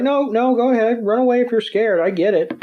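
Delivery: taunting. Retold or imitated. Iris taunting Georgia, a lightly voiced high mock. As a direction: goad her into running away. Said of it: "She's like".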